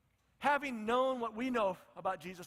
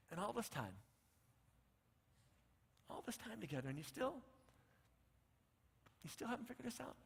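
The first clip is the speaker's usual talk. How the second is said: soft hurt; 11 dB quieter than usual. Real, not acted. Pastor softly hurt.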